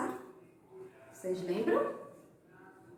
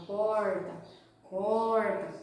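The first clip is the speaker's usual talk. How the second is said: curled retroflex R of Minas and São Paulo countryside; Minas caipira accent; much slower than usual. Shown as a demonstration. Teacher imitating people from Minas, caipira R. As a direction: imitate a mineiro's rolled-back R. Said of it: "aquele sotaque com R bem enrolado".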